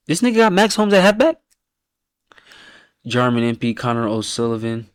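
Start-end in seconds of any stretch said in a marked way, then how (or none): 0.1-1.4 high, stunned disbelief; can't believe the pick, voice jumps up; incredulous. Real, not acted. Reacting to a friend's AFL team, incredulous high voice.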